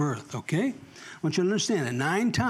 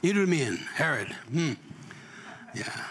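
usual speaking voice

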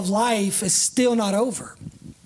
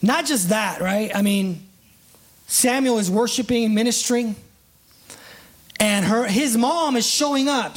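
ordinary delivery

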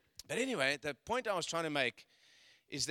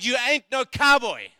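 teasing; 7 semitones higher, 18 dB louder than usual. Retold or imitated. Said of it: "So I said".

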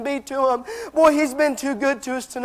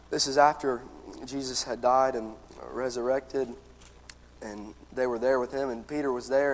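softer, lower measured King James reading; scripture reading; 8 semitones lower, 4 dB quieter than usual. Real, not acted. Preacher reading John to the church, soft solemn scripture reading.

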